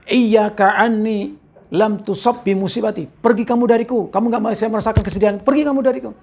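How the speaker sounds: lightly acted indignant rebuff; retold anger. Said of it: "perempuan tadi marah".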